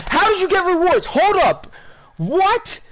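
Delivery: high, loud yelling in disbelief; real outburst, high-pitched shouted questions; startled yell. Real, not acted. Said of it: "Sorry if I just yelled at you".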